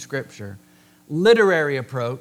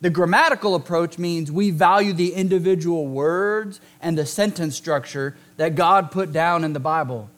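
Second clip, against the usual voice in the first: usual speaking voice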